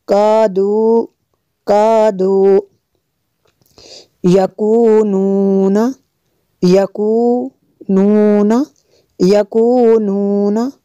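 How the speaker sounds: slow recitation. Shown as a demonstration. Echo recitation drill.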